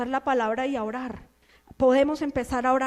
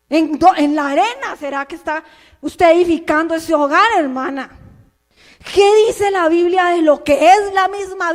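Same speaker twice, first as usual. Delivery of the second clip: loud preaching; 4 semitones higher, 9 dB louder than usual. Real, not acted. Preacher confronting the sister.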